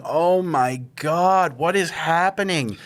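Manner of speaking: slow, drawn-out mock disbelief; retold dread. Husband recalling watching his wife in the tube, mild dread. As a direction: stretch it out in helpless, retold alarm.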